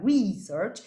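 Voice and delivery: research stressed on the first syllable, RE-search; noun stress. Shown as a demonstration. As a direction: say research with the weight on RE. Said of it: "cambiándole, eh, la acentuación a la palabra".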